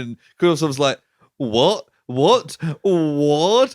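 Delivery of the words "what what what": mock stunned, rising with each word; mounting disbelief. Accused man reacting to the money found, in mock disbelief.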